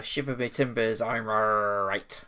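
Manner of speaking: a hammed-up West Country pirate accent, rolling the words. Co-host opens the podcast episode as a pirate, in a hammed-up pirate accent. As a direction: play the old sea dog greeting the listeners in pirate accent. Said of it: "me doing a pirate accent".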